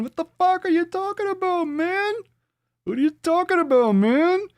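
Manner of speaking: very high, squealed exasperation; mock freak-out. Host losing it over a baffling tip, squealed high.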